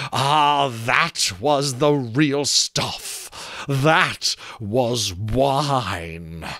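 very slow, relishing each word; a skull blissful after a drink; savouring skull. Acted. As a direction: linger over it like the best wine ever.

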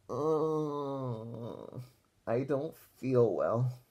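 slow sick moan, dragging the words. Acted. D W moaning to Grandma that she feels ill. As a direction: play up feeling ill with a moan. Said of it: "made a loud moaning sound".

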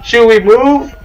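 high, loud mock-panicked call; play alarm. Getaway driver to crew at engine failure, play alarm. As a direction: raise a pretend emergency alarm. Pretend engine emergency called out.

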